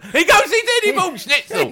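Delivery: high laughing retelling. Retold or imitated. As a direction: crack up as you say it.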